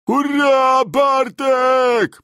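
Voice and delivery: high, loud held cheer; soldiers cheering. A narrator's reading. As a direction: cheer the hero at full voice. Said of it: "powtórzyli żołnierze". (żołnierze głośno wiwatują na cześć Bartka)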